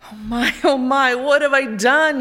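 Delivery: the king moans over his own cruelty; moaning. A narrator's reading. The guilty king moans aloud at night. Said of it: "he moaned".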